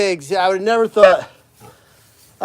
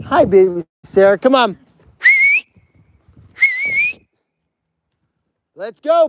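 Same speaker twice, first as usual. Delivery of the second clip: calling pigs; 9 semitones higher, 4 dB louder than usual. Real, not acted.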